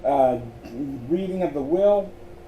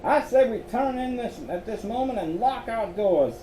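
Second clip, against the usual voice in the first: put-on higher Southern-accented man; 8 semitones higher than usual. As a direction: say it like a nervous Southern cousin. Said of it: "with the same southern accent".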